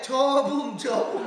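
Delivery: 저붐 in Chungcheong dialect, said loudly as a drill; coaxing the child to repeat 저붐, in Chungcheong dialect; Chungcheong dialect word. Retold or imitated. Grandmother drilling the baby to say 저붐, loud Chungcheong dialect. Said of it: "사투리로 젓가락을 뭐라고? 저붐이라고 그러잖아".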